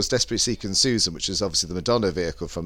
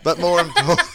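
laughing; 9 semitones higher, 3 dB louder than usual. Real, not acted. Host laughs at own slip.

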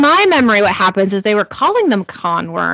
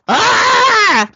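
pained scream; 10 semitones higher than usual. Acted. Character screams as worm enters.

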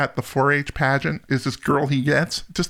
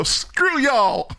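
slow, drawn-out shout, higher pitched; defiant shout; 10 semitones higher than usual. Acted. Telling off the media's list with a slow mock-defiant shout.

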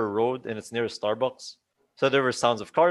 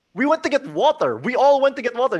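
high, insistent mock protest; 12 semitones higher, 5 dB louder than usual. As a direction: insist much higher, hammed up. Friends protest to Mark in retelling.

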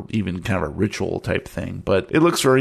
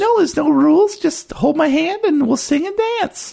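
put-on very high, piping child voice; little girl. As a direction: play a little girl luring someone into a game, pitched way up. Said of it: "one of the little girls".

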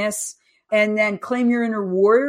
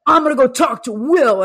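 louder, huffy mom muttering to herself; half-playful angry mom voice; mock mad mom; 5 semitones higher, 6 dB louder than usual. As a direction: storm off like an annoyed mother. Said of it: "I was like the mom mad".

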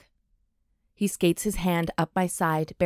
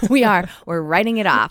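laughing; 2 semitones higher, 6 dB louder than usual. Real, not acted. Laughing about business dinner write-off.

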